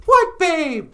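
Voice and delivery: very high, loud puppet cooing. Pretend. Puppet Natasha playing the Labyrinth bit, very high. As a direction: coo it as the puppet.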